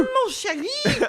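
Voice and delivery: high, slow mock French swoon; French accent. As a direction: croon it like a campy Parisian. Reprising French bit.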